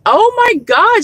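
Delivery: high, startled oh my God; pleasantly shocked, pitch jumping way up; surprised gasp. Retold or imitated. Retelling her surprise at a stranger's views, high and startled. Inner shock at woman's view.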